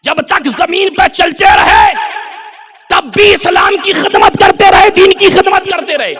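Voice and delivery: high, ringing preacher's cry; rousing preaching. Acted. Preacher praising the saints' service to Islam, rousing. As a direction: call it out high in separate phrases.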